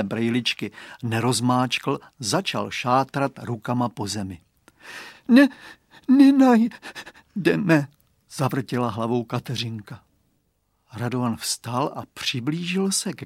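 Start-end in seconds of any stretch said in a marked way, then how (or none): 5.3-7.9 very high, very slow, crying little voice; a small girl hopeless through tears; tearful refusal. Acted. Crying girl shakes head.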